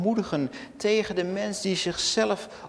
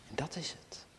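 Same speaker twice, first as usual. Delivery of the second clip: soft whispered conclusion; 4 semitones lower, 14 dB quieter than usual. Acted. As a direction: breathe it to the congregation. Sermon climax, sudden hush.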